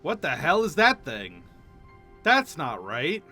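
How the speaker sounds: usual speaking voice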